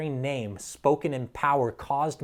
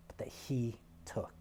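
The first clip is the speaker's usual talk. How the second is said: hushed whisper; 11 dB quieter than usual. Real, not acted. Pastor whispers the sacrifice to the congregation, hushed. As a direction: drop to a bare whisper.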